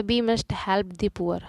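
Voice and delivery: very slow, word-by-word reading; slow dictation. Shown as a demonstration. Teacher dictating an example sentence to students. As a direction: dictate it slowly. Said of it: "teacher reads example slowly".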